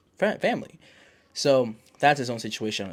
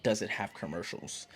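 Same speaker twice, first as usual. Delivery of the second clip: usual speaking voice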